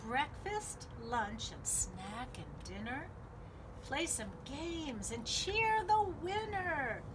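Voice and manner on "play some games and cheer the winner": ordinary delivery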